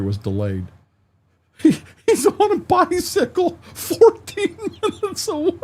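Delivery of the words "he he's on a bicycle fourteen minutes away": very high, squealed words through laughter; louder, wheezy real laughing fit; helpless laughter. Real, not acted. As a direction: barely get the words out for laughing. Helpless laughter at bicycle courier.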